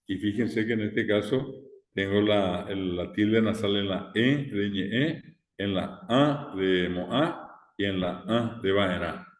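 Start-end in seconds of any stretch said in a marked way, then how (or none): none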